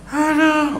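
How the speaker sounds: high-pitched "aduh" wail of pain; hammed-up toothache moan; cry of pain. Preacher mimics someone with a toothache, hammed-up pained wail.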